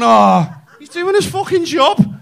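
high, loud, drawn-out mock defence; mocking imitation. Comic mimics the public defending Dec, mockingly. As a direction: parrot the excuse slowly, pitched right up. Mocks what everyone says about Dec.

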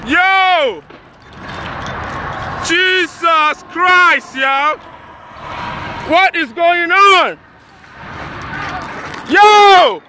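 loud, high alarmed yells at the crash; yelling yo in real shock. Real, not acted. Bystander filming a burning trailer, shouting in alarm. Witnessing a fiery highway crash.

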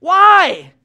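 high, loud, baffled; incredulous why. Acted. A townsman asks why the king collects them, loud and high. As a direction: demand the reason.

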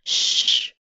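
voiceless "shh" to hush someone; shushing hiss. A narrator's reading. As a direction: hush your companion. Boy hushing his sister.

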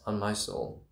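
soft, firm vow; quiet resolve. Acted.

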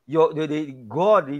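normal talking voice

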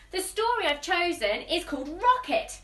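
usual speaking voice

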